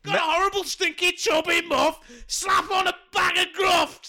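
very high, hammed-up slogan yell; mock advert shout. Co-host selling gruft in a fake ad, mock shouting. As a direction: belt out the silly jingle line, high and stretched.

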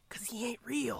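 secret whisper to the wife; dad secretly telling wife God isn't real. Pretend. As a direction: whisper it so the kid can't hear.

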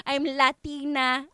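normal talking voice

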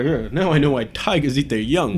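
usual speaking voice

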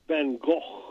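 Van Gogh tried in a Dutch accent, throaty fan khokh; a stab at the Dutch accent's Van Gogh; Dutch accent attempt. Shown as a demonstration. Host imitating a Dutch friend, trying the Dutch accent. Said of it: "try to pronounce it like, like he does".

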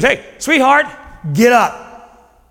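lightly acted, coaxing but insistent; waking a teenager. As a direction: call a sleeper out of bed with an endearment. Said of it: "like he's waking up a stubborn teenager".

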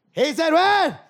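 loud, high mock bewildered father; deaf father. Comic imitating his hard-of-hearing father, loud and high. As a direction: shout it like you missed every word. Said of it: "my father can't hear".

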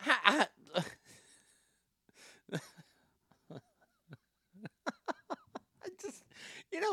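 laughing too hard to speak; stammering through wheezy laughs; helpless laughter. Real, not acted. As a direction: break up laughing between stammers. Laughing at the statement just read.

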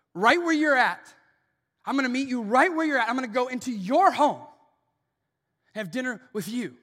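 high, loud, fast insistent promise; loud rapid sermon proclamation; preaching at full force. Acted. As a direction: drive the promise home hard.

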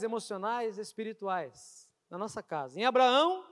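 ordinary delivery

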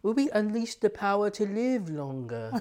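high, mock-villain accent out of nowhere; sudden put-on evil-genius accent, hammed up; playful supervillain musing about living longer; Doctor Evil accent. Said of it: "I don't know why I have that accent suddenly".

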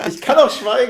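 mock dramatic. Joking about saving his voice.

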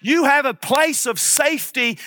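usual speaking voice

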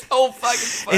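laughing, voice high. Real, not acted. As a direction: laugh through the words. Laughing at own retelling.

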